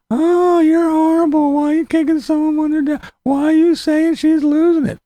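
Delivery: very high whiny mock-critic voice. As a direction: whine like an outraged commenter. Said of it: "everybody doesn't come over here and run".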